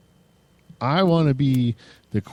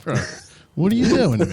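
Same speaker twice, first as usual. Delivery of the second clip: 1 laughing mock plea; 7 semitones higher, 3 dB louder, faster than usual. Retelling a friend's complaint.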